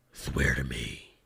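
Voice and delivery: mocking the gravelly movie Batman. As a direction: rasp it deep and menacing like Bale's Batman.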